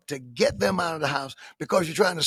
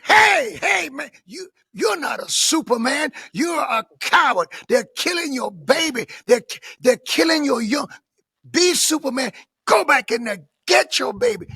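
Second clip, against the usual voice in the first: raised, high challenge; urgent confrontation; 6 semitones higher than usual. Retold or imitated. Protester confronting a man outside the clinic, urgent and forceful. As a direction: call him out forcefully.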